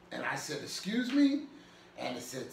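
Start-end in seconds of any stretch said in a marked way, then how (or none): 0.6-1.4 high, questioning disbelief; mock-startled query; retold surprise. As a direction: jump up in pitch as if taken aback. Retells startled reply to command.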